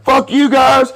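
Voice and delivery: high, defiant shout; mock defiant yell. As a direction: yell it back at them. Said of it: "I was like".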